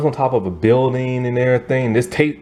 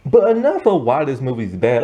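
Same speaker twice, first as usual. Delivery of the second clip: ordinary talk, nothing marked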